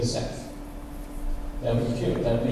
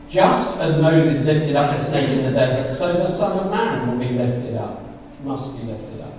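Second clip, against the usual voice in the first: scripture reading; 5 semitones lower than usual. Acted. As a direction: read the verse with weight. Reads verse fourteen.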